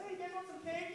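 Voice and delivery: high, soft motherly offer; sweet little high-pitched hostess voice. Acted. Acting out the mother offering cake.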